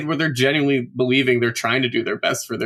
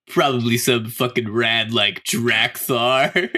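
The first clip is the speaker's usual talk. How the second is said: slow drawl; slower than usual. Retold or imitated. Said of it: "just went".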